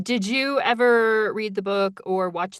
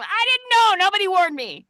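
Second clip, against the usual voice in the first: high indignant whine; playful high-pitched complaint; mock protest; 13 semitones higher, 5 dB louder than usual. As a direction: whine like you were betrayed. Retold protest to friends.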